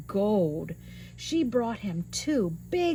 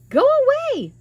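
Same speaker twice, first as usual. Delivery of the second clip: giant's wife, dismissive; 10 dB louder, much faster than usual. Acted. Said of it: "she told him".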